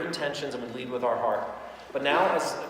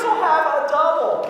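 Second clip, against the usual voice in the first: laughing quote; 14 semitones higher, 9 dB louder, slower than usual. Retold or imitated. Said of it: "She goes".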